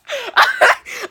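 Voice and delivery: loud, high-pitched burst of laughter. Real, not acted. Laughing over mom's failed punch, loud high laugh. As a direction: crack up loudly and high.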